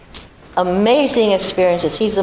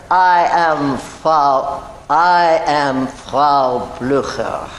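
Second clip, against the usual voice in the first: put-on drawn-out, lower-pitched German-accented delivery; very slow, unconfident; 5 semitones lower, much slower than usual. Actress's first line on set, not confident yet, very slow. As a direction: stretch every word out carefully in a low voice. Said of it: "I said very slowly because I wasn't confident yet".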